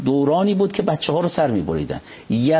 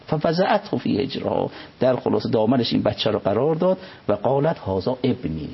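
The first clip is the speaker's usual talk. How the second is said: normal talking voice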